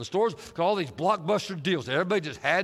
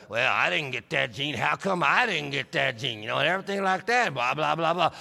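a lower, griping mock whine; mock whiny complaint; 4 semitones lower than usual. Preacher mimicking a complainer in the congregation, mock whiny gripe. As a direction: whine like someone denied the gene. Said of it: "griping and complaining".

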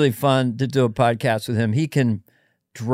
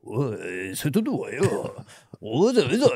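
a hushed fake Japanese accent, jumping in pitch; imitating a Japanese accent, quietly; mock Japanese accent; 5 dB quieter than usual. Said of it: "dialect of a Japanese accent".

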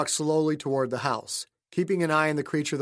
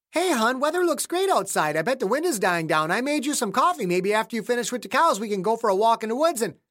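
higher, bright wife's voice, breezy and chatty; Stephanie's chatty voice; 7 semitones higher, faster than usual. Acted. Wife to James, cheerfully chatting over coffee.

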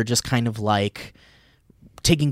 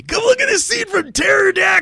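loud, very high-pitched shouted impression; Andy; 4 dB louder than usual. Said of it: "That's my impression of Andy".

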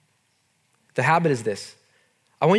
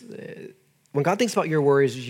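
usual speaking voice

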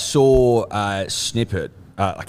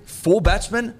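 mock outrage; 7 semitones higher than usual. Heated mock rant at Sachin.

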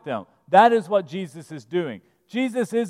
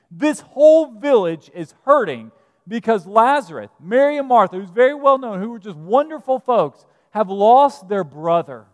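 louder, preaching hard to the congregation; impassioned preaching; 2 semitones higher, 7 dB louder than usual. Acted. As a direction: press the grief home from the pulpit. Preacher rises to full force.

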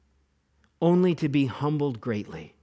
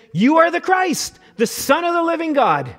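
bold confession; 11 semitones higher, 6 dB louder than usual. Retold or imitated.

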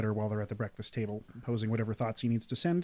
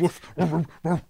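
louder, playful woofing; mock dog woofs; 6 semitones higher, 7 dB louder than usual. Game master playing a dog visitor, loud playful woofs. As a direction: woof like an excited dog at the door.

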